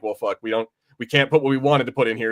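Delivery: normal talking voice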